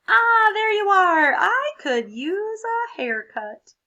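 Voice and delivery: slow, high, loud grand voice; hammed-up greeting. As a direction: welcome them theatrically. Crayon greeting at fort.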